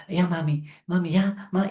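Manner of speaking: put-on a small child pleading. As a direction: beg like a scared kid chasing his mother's attention. Child begging a silent mother.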